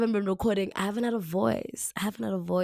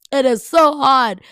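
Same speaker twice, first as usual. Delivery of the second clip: loud, high, drawn-out stress on "so hard"; emphatic; 6 semitones higher, 14 dB louder than usual. Real, not acted. Podcaster admitting consistency is difficult, emphatic. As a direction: lean hard on "so hard", slowly and loudly.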